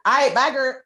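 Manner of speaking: put-on high breezy bye girl; sassy goodbye. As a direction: wave them off with flair. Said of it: "ceremoniously like".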